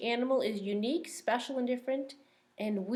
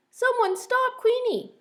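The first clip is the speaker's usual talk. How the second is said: much higher, louder desperate cry; 8 dB louder than usual. Acted. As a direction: cry out for the stolen quilt. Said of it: "cries Quincy".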